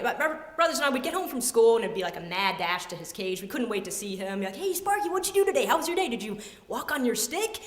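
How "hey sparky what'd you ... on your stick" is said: put-on high-pitched eager kid; excited greeting. Kids home from school greeting their lizard, excited. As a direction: gush questions at a beloved pet.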